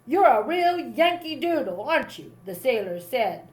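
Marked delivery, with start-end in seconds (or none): 0.0-2.3 sneer. A narrator's reading. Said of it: "with a sneer".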